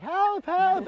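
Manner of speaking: very high mock cry for help; imitated screaming. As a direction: imitate a panicked client screaming. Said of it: "So she starts screaming".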